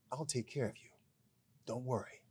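quiet, calm reassurance to a customer; reassuring shop clerk. Retold or imitated. Clerk reassures the nervous husband quietly. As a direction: softly promise you'll handle it. He looks at me and says.

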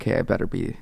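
ordinary talk

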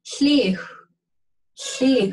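"Hliw" opened with air blown past a tongue set for T; "Hliw" with a voiceless slushy L; juicy barred L. Shown as a demonstration. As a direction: blow a juicy hl at the start of "Hliw". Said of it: "what they say is a juicy sound".

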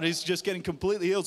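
ordinary talk, nothing marked

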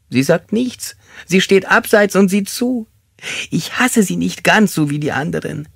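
very high, plaintive character voice; whimpering suspect. Acted. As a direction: recount the dream in a high whine.

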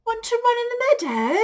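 very high, loud, quick sheep; bleating sheep's voice. Acted. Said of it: "bleated the sheep".

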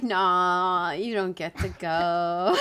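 low, slow, smug mock taunt; taunting ghost voice. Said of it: "It was like".

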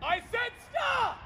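loud, slow, high shout of stop; practicing a self-defense yell; firm yell. Shown as a demonstration. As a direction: shout it to make them back off. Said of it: "I want you to yell".

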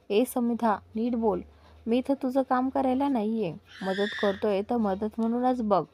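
plain reading voice

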